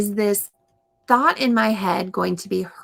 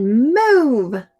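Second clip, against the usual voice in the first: loud high bossy "Move"; demanding "Move"; bossy command; 3 semitones higher, 6 dB louder than usual. Shown as a demonstration. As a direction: snap "Move" at a friend like a bossy kid. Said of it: "Have you ever been bossy".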